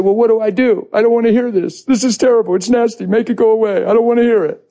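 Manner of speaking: high, pleading whine to God; whiny plea. Retold or imitated. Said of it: "Isn't that how we talk?".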